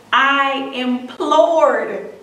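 "I implored" stretched very slowly, leaning hard on "implored"; drawn-out emphasis. Shown as a demonstration. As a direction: draw out "implored" like a plea. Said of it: "like he begged".

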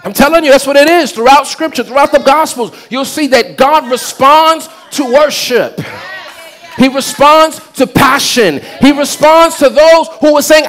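rising, driving sermon voice; full-force preaching. Real, not acted. Preacher promising the congregation what worshipers get, full-force preaching.

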